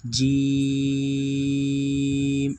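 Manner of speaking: "جیم" with a clear j and very long ee; drawn-out jeem. Shown as a demonstration. Teacher showing how the letter is read, stretched out. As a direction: hold the vowel of "جیم" steady and long. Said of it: "تو کیا پڑھنی ہے؟".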